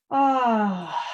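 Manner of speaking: long falling open sigh; audible sigh. Shown as a demonstration. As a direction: let out an audible "ah" on the breath. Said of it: "have some nice audible sighs".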